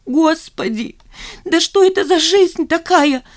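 high, exasperated squeal; shrieking. A narrator's reading. Zina wailing at her colleagues about her life, shrieking. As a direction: squeal it out in despair. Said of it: "взвизгнула Зина".

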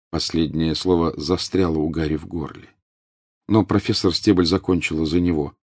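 ordinary delivery, nothing marked

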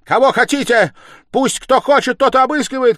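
high, loud defiant challenge; furious shouting. A narrator's reading. Stepmother inviting anyone to search, shouting defiantly. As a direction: yell out the dare. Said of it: "кричала Катерина Ивановна".

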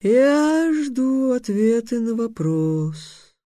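high, very slow intoning; eerie, drawn-out high declaration; sphinx's solemn pronouncement. A narrator's reading. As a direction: stretch every word like a riddling monster. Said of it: "Сфинкс откашлялся и произнёс".

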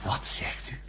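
breathless whisper, caught off guard; shocked whisper. Acted. Doctor to Schmol, hearing Erika quit: stunned whisper. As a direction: barely voice it, stunned.

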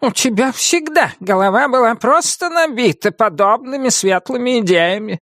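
high, cross witch voice; snapping witch. A narrator's reading. Witch answers Нептолиум's bright idea, snapping. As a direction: snap back in a high witch voice. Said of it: "огрызнулась ведьма".

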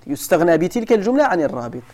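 normal talking voice

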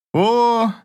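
high, knowing "oh"; a teasing, secretive exclamation; mysterious. A narrator's reading. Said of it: "загадочно ответил Аверин".

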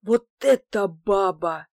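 slow, emphatic delighted praise; moved admiration. A narrator's reading. Man admires the woman bringing beer, slow moved admiration. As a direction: savour it, greatly touched. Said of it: "чуть не всплакнул от умиления".

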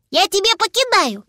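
put-on high, childlike offer; little dragon. Little dragon offering to toss Papa a syrnik, high-voiced.

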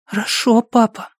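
put-on high child's voice; small boy telling a dream; little boy voice.

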